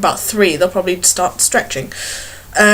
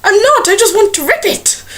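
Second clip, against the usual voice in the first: high, squeaky innocent denial; mock protest; 10 semitones higher than usual. Vlogger denying she is aggressive to everyone. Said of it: "I'm like".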